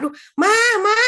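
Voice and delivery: high, loud acted holler; yelling for Mom. Father calling mother to take the child, yelling. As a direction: call out for help, exasperated. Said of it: "teriak sama mamanya".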